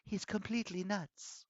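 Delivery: put-on soft, high girl's whisper. A narrator's reading. Bianca whispering that villain is crazy, hushed whisper. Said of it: "Bianca whispered to me".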